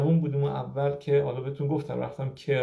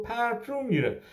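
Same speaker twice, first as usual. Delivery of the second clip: higher-pitched Kermani dialect phrase; Kermani accent; 8 semitones higher than usual. Retold or imitated. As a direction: repeat it imitating a Kermani patient's accent. (تکرار با لهجهٔ کرمانی)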